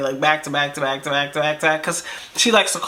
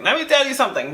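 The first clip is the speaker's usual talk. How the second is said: much higher pitched, insistent retelling; insistent warning; 8 semitones higher, faster than usual. Retold or imitated. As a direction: lift the pitch sharply to demand attention. Repeats the warning, escalating to him.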